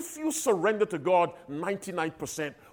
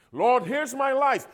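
lightly voiced pious offering, pitched higher; a hint of a showy prayer, lifted up high; mock grand surrender; 9 semitones higher than usual. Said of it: "Here's how some people surrender themselves".

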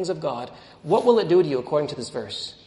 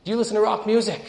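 raised, louder quizzing voice; retold interrogation, pitched up; acted probing question; 8 semitones higher, 6 dB louder, faster than usual. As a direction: fire the question like a checklist item. Witch quizzes missionary in story.

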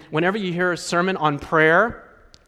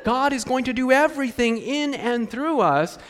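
mock pious quote; 7 semitones higher than usual. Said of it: "we say".

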